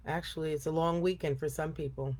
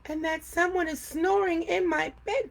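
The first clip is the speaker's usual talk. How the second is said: much higher little cub voice; Baby Bear crying out; 11 semitones higher than usual. A narrator's reading. Baby Bear crying out to his parents, high squeal. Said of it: "cried Baby Bear".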